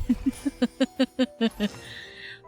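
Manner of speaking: trailing run of giggles; laughing. Real, not acted. Streamer amused at being the only one, trailing laughter. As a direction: giggle on in quick little bursts.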